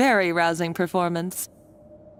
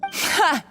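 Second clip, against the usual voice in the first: sharp mocking single laugh; scornful ha; 9 semitones higher than usual. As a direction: scoff at her pretensions.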